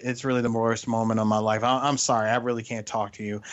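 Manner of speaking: usual speaking voice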